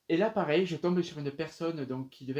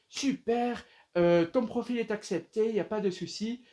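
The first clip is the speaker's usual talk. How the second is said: acted recruiter, higher and louder; imitation d'enthousiasme; 6 semitones higher, 3 dB louder than usual. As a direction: announce good news in a raised, put-on voice. Imite le recruteur qui accepte. (enthousiasme de recruteur, imité)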